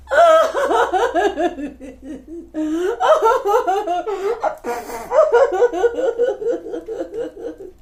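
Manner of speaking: loud, high pulsing wail; hammed-up cartoon boo-hoo sobbing; mock crying. Storyteller acts the bug girl crying, hammed-up wailing. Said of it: "A bug girl crying".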